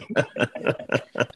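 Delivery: soft unvoiced chuckle; real quiet laugh. Real, not acted. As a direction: chuckle at the irony. Laughs at cartoon irony.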